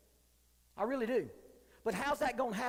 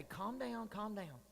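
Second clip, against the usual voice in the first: softer self-talk, calm down twice; calming himself; 2 semitones lower, 9 dB quieter, much slower than usual. Retold or imitated. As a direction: talk yourself down quietly. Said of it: "and say".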